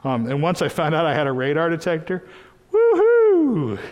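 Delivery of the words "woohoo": high, loud woohoo; excited whoop. Real, not acted. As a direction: whoop with joy. Excited about radar detector.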